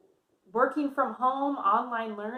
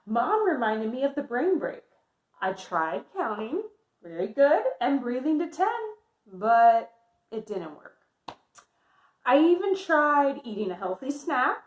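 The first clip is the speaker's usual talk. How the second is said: lively picture-book read-aloud voice; reading to children; 3 semitones higher, 7 dB louder than usual. Acted. Teacher reading Amelia's story to her class, lively.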